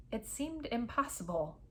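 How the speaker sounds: ordinary delivery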